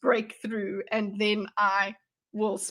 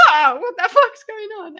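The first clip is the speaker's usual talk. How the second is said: mimicked yelp on waking, higher and louder; startled awake; 7 semitones higher, 6 dB louder than usual. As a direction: act jolted out of sleep, loud and confused.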